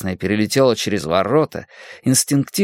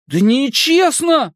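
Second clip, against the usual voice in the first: whiny shout; 13 semitones higher, slower than usual. Acted. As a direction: wail that it's unfair. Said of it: "повторил Питер".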